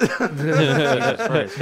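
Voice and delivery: slow, strained from spicy heat; burning mouth. Real, not acted. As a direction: gasp through hot sauce burn. Reacting to the hot wing.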